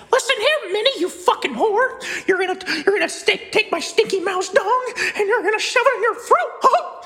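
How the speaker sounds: very high cartoon mouse squeak; high shrill cartoon mouse voice; squeaky mouse voice. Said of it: "What other voices can I do?".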